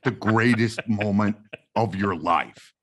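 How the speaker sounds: slow, emphatic character voice; deliberate, halting retold protest; Kevin voice. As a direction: put weight on every word, drawn out. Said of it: "you gotta do the Kevin voice".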